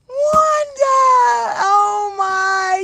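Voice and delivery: very high, loud drawn-out cry; gushing exclamation. Real, not acted. Streamer thanking an encouraging viewer, high loud gushing. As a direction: sing out the viewer's name with delight.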